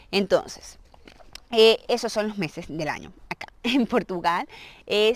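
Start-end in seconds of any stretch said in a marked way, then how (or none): none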